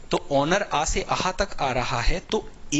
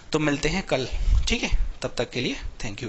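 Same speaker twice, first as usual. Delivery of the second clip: ordinary talk, nothing marked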